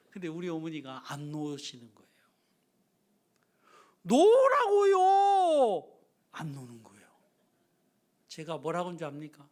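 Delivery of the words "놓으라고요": very slow, high, loud yell; drawn-out yell. Retold or imitated. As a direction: stretch it out in exasperation. Son yelling to let go. (아주 길게 높이 외치는 고함)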